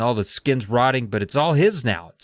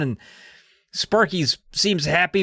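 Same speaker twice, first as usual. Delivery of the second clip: ordinary delivery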